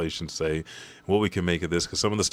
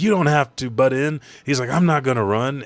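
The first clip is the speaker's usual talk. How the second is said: raised, higher voice for a quarrel; heated retort; 6 semitones higher, 6 dB louder than usual. Retold or imitated. Wounded fighter refusing help from his ally, heated retort. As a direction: push back loudly as the character. Acts out characters arguing.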